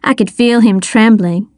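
ordinary delivery, nothing marked

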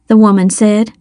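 ordinary delivery, nothing marked

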